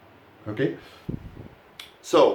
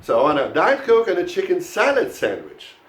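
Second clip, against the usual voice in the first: full order with salad as the key word; stress on salad; 3 semitones higher than usual. Shown as a demonstration. Teacher models the whole order for the class, stressing salad. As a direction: run through the order, lift salad, fall on sandwich. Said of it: "you stress the word salad".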